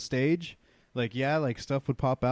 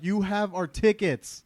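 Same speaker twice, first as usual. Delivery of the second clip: retold yell; 7 semitones higher, slower than usual. Yelling after the friend who took off, mock yell. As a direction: call it out slowly in a raised pitch. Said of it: "and I yelled out, like".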